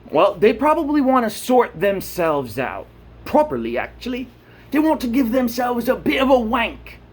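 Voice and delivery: light mock British; a hint of British. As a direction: tease in a cheeky British voice. British slang, put-on voice.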